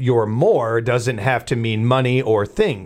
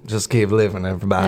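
McConaughey drawl; 3 semitones lower, faster than usual. Retold or imitated. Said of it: "Matthew McConaughey loves to tell us".

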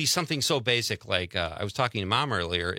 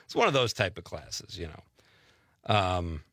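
ordinary talk, nothing marked

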